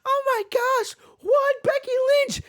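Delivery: very high, gushing fangirl squeal. Retold or imitated. Bianca gushing over Becky like a fangirl. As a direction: gush like a starstruck fan. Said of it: "Bianca's over there all fangirl".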